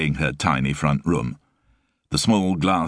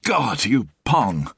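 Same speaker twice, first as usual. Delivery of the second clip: disgusted; 6 semitones higher, slower than usual. Acted. Sue disgusted at Frost.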